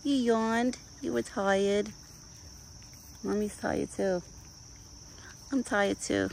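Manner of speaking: pet talk. Real, not acted. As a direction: tell him softly you're tired too. Cooing at her deer.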